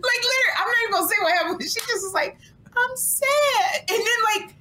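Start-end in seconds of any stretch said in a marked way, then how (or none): 2.8-3.8 high-pitched mock-tearful admission; mock crying. Friend retelling Kim's rare breakdown, high mock-tearful voice. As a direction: imitate a friend about to cry. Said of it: "she starts crying".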